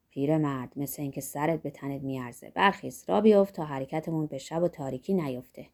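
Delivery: lightly voiced Zorba, low pitched; Zorba, approving companion. A narrator's reading. Zorba tells the old monk to get moving, mildly approving.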